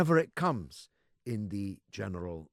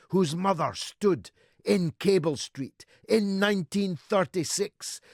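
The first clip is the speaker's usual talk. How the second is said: loud, high-pitched political speech; impassioned oratory; 9 semitones higher, 9 dB louder than usual. Real, not acted. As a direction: ring it out like a rally speaker. Impassioned political oratory at full force.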